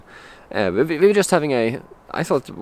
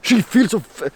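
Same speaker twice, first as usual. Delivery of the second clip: higher mock police officer, louder on you have; mock the officer's solemn warning, pitched up; 9 semitones higher, 3 dB louder than usual. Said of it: "And like".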